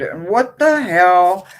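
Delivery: sudden shock. Real, not acted. Discovers the split card.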